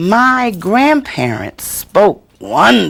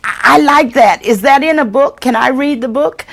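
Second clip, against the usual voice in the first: put-on high, loud, eager child's voice; thrilled child asking for the book; keen young reader; 8 semitones higher, 5 dB louder than usual. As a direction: ask like a child hooked on a book. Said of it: "you hear".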